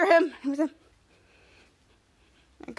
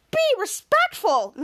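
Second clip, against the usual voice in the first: scolding; 8 semitones higher, 3 dB louder, slower than usual. Acted. Scolding a friend for rudeness.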